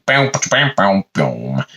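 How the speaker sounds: imitated sitcom sting. Cutting to laugh track and commercial, mimicked sting.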